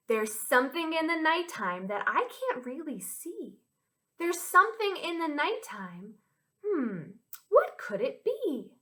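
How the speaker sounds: put-on lilting children's rhyme with swooping pitch; rhyme recital. Teacher sharing a nighttime rhyme with children, lilting. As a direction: chant it with playful mystery. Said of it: "this rhyme goes like this".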